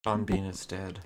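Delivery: mock grave announcement. Joking dramatic line.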